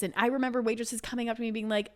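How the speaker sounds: ordinary delivery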